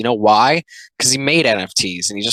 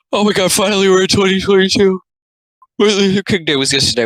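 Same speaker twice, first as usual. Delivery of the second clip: high-pitched, louder mock exclamation; put-on comic character; 9 semitones higher, 4 dB louder, faster than usual. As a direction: squeal it as an over-the-top cartoon character. Reads comic line in put-on voice.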